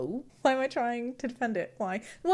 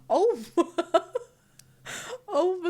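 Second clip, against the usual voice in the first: laughing; 7 semitones higher than usual. Real, not acted. Laughing at baby drawing.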